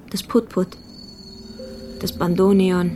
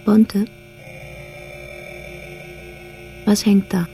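rising fear at what dangles below; growing dread. Acted. Jeanette peering into the dark shaft, growing fear. Discovering something hanging in shaft.

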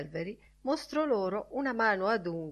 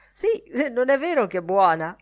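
raised, louder, eager question; sudden animation; 5 semitones higher, 7 dB louder than usual. A narrator's reading. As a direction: push eagerly for agreement. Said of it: "con improvvisa animazione".

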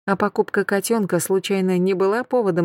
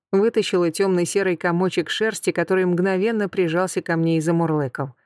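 plain reading voice

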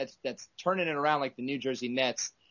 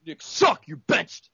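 high, fired-up shout; 4 semitones higher, 4 dB louder, slower than usual. Real, not acted. Host finishing a rant against the Knicks, fired-up shout. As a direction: blast the team and bench it. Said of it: "I got fired up".

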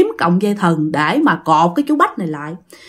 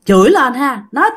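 ordinary delivery

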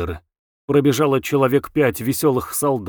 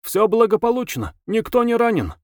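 conductor asking; 9 semitones higher than usual. A narrator's reading. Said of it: "спрашивая".

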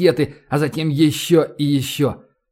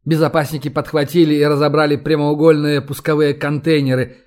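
ordinary delivery, nothing marked

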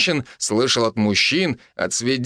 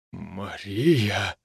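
low, hushed, choked utterance of the name; strangled voice; 4 semitones lower, 5 dB quieter than usual. A narrator's reading. Husband finds his wife with another man, strangled. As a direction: squeeze it out softly, pitch dropped. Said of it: "сдавленный, почти неузнаваемый голос своего мужа".

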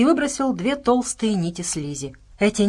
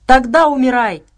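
loud, high furious cry; a giant yelling a death threat; shouted threat; 5 semitones higher, 9 dB louder than usual. A narrator's reading. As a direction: scream the threat at him. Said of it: "прокричал сел-великан".

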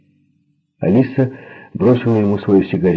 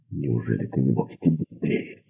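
hushed, low complaint; soft reproach under the breath; whispered reproach; 4 semitones lower, 6 dB quieter than usual. A narrator's reading. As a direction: whisper crossly from just behind. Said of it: "прошептала за его спиной Алиса".

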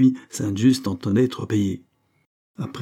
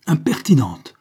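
offended cry; 6 semitones higher, 6 dB louder than usual. A narrator's reading. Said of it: "s'écria la matrone offensée".

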